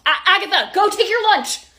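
high, loud urgent command; panicked order. Acted. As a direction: shout it across to your employee. Manager panics over HR call.

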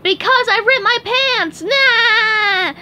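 high, loud gleeful shout; cried out. Acted. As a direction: crow the joke triumphantly. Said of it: "and cried".

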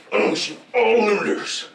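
robot announcer voice. Acted. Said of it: "he goes".

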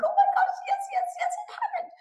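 very high, shrieked oh my gosh yes yes; giddy celebration of a manifested wish; ecstatic squeal. Shown as a demonstration. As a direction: squeal with overjoyed relief. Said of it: "emotion on the positive side".

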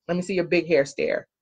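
usual speaking voice

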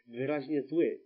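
usual speaking voice

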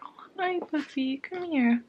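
high, soft coo to the puppy; sweet talk the dog close; pet talk. Real, not acted. Greeting her puppy, high soft coo.

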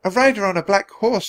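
put-on high, light child's voice; little girl. A narrator's reading. Annie answering the centurion, girlish.

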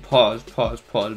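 slight lisp from retainers; talking through a retainer; wearing a retainer. Real, not acted. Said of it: "I got my retainers in right now".

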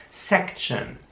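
"Section" with a sharp hissed S, whispered; "Section" with very sharp S at start. Shown as a demonstration. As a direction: hiss the S of "Section". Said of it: "das S am Anfang sehr scharf".

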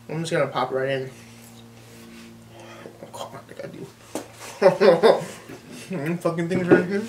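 talking while chewing; muffled through food; mouth full. Real, not acted. Seen 2: Whole pickle popped in at -3; cheeks puffed, chewing while talking.

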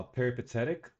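normal talking voice

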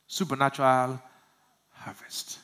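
supernatural harvest whispered. Shown as a demonstration. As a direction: whisper the key word. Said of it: "Supernatural what?".

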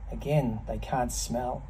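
ordinary talk, nothing marked

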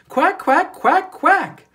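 high, loud duck quacks; loud quacking. A narrator's reading. Ducklings quacking loudly at the passing cars, high and loud. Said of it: "just as loud as their little quackers could quack".

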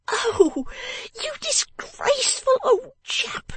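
very high, drawn-out reproach; outraged scolding. A narrator's reading. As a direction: shame him with a high wailing cry. Miss Wren scolding her father.

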